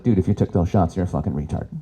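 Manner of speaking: low, blunt crude voice; inner comedian. Acted. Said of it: "the comedian in me is like".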